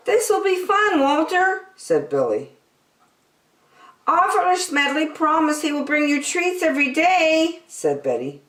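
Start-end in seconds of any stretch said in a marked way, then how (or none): 0.1-1.7 put-on high child's voice; cheerful little boy; Billy. A narrator's reading. Billy speaks. 4.1-7.6 put-on high girl's voice; sweet little girl reassuring; Betty. A narrator's reading. Betty speaks.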